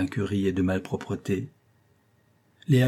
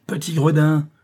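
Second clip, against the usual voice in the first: higher, louder insult; raised-voice telling-off; angry scolding; 6 semitones higher, 5 dB louder than usual. A narrator's reading. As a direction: berate a naughty pupil. Teacher scolding pupil.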